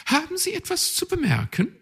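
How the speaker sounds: snapping back, pert; high sharp magpie voice. A narrator's reading. Magpie challenges the raven, high and pert.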